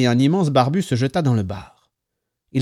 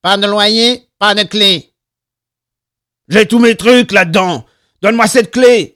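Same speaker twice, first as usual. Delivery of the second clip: high, loud heated demand; raised-voice quarrel; angry arguing; 7 semitones higher, 10 dB louder than usual. A narrator's reading. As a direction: argue hard at the top of your voice. Loud quarrel over rent and key.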